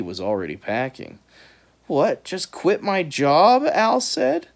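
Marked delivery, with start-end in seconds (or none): 1.9-3.6 surprised. A narrator's reading. Al objects.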